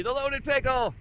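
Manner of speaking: calls out the name high and proud; proudly announcing her channel; excited announcement. Real, not acted. Telling the bus her channel name.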